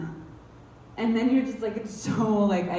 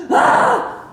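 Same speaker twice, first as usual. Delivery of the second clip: panicked gasp; 11 dB louder than usual. Retold or imitated. Gasp of fear on freeway.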